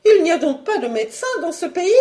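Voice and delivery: high, quick questioning; fast, exasperated wife; indignant challenge. Acted. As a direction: challenge his excuse in a high voice.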